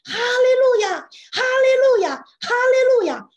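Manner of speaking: shouted praise; loud praise. Shown as a demonstration. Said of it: "如果你大声的在那里赞美".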